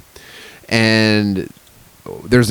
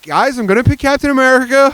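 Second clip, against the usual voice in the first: imitating eager kid; 12 semitones higher than usual. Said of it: "he's just like".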